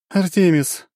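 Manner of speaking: high, suddenly grave; dark goddess turning serious. Acted. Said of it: "тёмная вдруг стала очень серьёзной".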